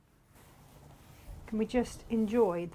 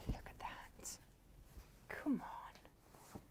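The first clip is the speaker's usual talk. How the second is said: hushed, voiceless marvelling; very soft reverent whisper; awed whisper; 4 semitones higher, 14 dB quieter, much slower than usual. Real, not acted. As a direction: whisper it in disbelief at something lovely. Awestruck whispering over fabric.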